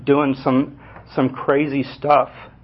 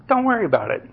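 higher, laughing mock of a carefree big brother; lightly voiced reckless older brother, amused; brother's carefree reply; 5 semitones higher, faster than usual. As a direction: brush off the worry with a laugh, higher. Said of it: "He said".

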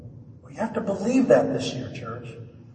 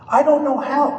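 high, loud mock protest; louder, higher, acted-out helplessness; doubter's protest; 10 semitones higher, 7 dB louder than usual. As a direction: throw it up as an exasperated objection. Acting out a doubter's protest to God.

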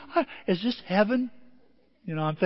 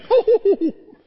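big laugh; 10 dB louder than usual. Real, not acted. Laughing at the bolts.

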